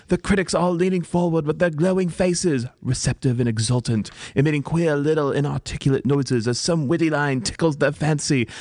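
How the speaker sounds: theatrical British monologue, climbing in pitch; British accent. Acted. Actress reliving first nights, swelling British accent. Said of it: "my accent is superb".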